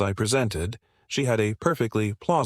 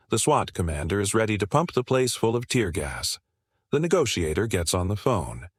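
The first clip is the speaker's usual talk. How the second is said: ordinary talk, nothing marked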